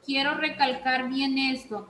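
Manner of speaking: ordinary talk, nothing marked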